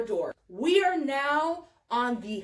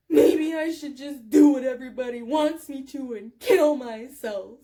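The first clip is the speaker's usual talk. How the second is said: loud hammed-up sobbing self-pity; tearful; 5 dB louder than usual. Friend bursting into tears at the teller, mock tearful wail. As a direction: imitate a crying drunk, falling at the end. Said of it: "she just burst into tears again".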